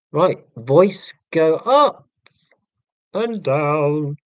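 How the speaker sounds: deliberately inflect up and down; exaggerated inflection. Shown as a demonstration. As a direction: voice swooping up then down. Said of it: "I have to consciously inflect my voice".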